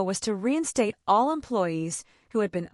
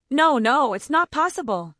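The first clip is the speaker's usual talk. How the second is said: stammering; 5 semitones higher, 5 dB louder than usual. A narrator's reading. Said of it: "she stammered".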